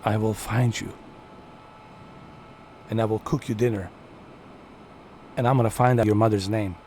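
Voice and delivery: lightly voiced mock movie threat; quick phrases with pauses between, mock-menacing; Liam Neeson. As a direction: lightly parody an action hero's threat. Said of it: "Liam Neeson is the best".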